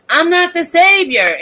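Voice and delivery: high, loud insistence on not being the savior; emphatic declaration. Real, not acted. Telling viewers she can't save everyone, declaring it loudly. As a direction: put your foot down on this.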